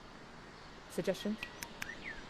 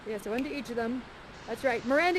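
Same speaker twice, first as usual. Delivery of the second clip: normal talking voice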